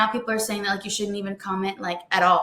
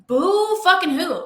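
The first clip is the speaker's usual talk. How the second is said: high, slow, drawn-out sneer; scornful mock pity; 7 semitones higher, 4 dB louder, slower than usual. Real, not acted. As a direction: draw out the fake sympathy. Scornful answer to commenter's complaint.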